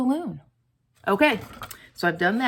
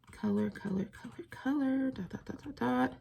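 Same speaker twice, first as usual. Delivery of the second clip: muttered to self; 4 semitones higher, 10 dB quieter than usual. Real, not acted. Muttering while colouring.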